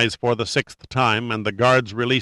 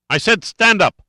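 screamed order, much higher; furious shout; 10 semitones higher, 5 dB louder than usual. A narrator's reading. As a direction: roar the command. Villain repeats order furiously.

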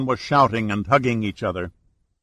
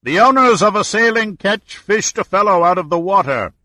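higher, louder call; shouting; 8 semitones higher, 5 dB louder than usual. A narrator's reading. Owner shouting the report to the ferry, loud call. Skipper shouting across water.

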